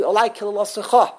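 KILILOSICHA run quickly to a punched final CHA; stress on last syllable. Shown as a demonstration. Teacher showing the default last-syllable emphasis, stress on CHA. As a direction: wrongly hit the CHA ending KILILOSICHA. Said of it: "it's the last syllable".

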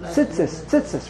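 tzitzis with short, swallowed vowels; chirik like shva. Shown as a demonstration. Teacher showing what everyone does, short-vowelled tzitzis. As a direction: squash tzitzis into "tsits-ss". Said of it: "Everyone does it".

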